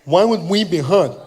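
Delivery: imitated deaf speech, puzzled question; partially deaf man. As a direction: ask with softened, imprecise consonants. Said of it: "I'm partially deaf".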